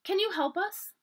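put-on high, hopeful child's question; hopeful plea. A narrator's reading. Samantha asking her teacher for help, hopeful. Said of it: "She looked at Miss Stevens hopefully".

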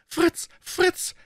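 high desperate woman's cry; pleading woman. Acted. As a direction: beg him to stop. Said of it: "rief sie".

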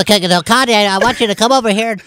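mocking the director. Actor mocking the stewardess video director, high put-on voice. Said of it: "he literally talks like this".

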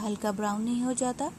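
usual speaking voice

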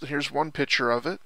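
ordinary delivery

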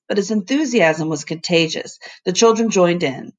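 ordinary delivery, nothing marked

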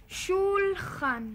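slow, word drawn out to teach. Acted. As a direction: spell it out for a learner. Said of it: "teaching grandma words".

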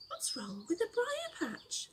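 high whispered curious fox; sly whispered question. Acted. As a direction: lean in, suspicious and curious. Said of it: "asked Brer Fox".

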